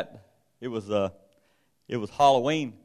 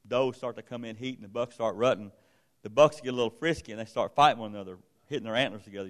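ordinary talk, nothing marked

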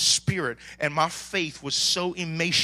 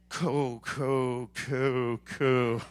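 halting tongues; 5 semitones lower, much slower than usual. Shown as a demonstration. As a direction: stammer the first tongues. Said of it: "it might start off like a".

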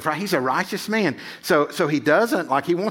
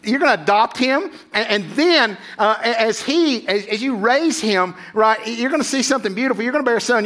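eager angel; 6 semitones higher than usual. Retold or imitated. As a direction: play the angel urging Joseph in a dream, high and pressing. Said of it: "And he said".

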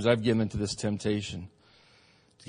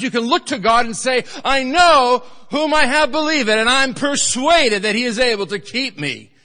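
full-force preaching; 9 semitones higher, 9 dB louder than usual. Acted. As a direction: preach it home, building to a shout.

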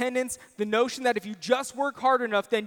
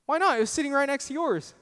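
indignant wife; 6 semitones higher, faster than usual. Retold or imitated. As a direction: playfully imitate his wife's exasperated comeback. Wife's retort in retold quarrel.

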